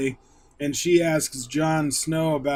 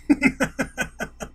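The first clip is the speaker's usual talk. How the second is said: laugh; 10 semitones higher than usual. Real, not acted. Laughing at own joke.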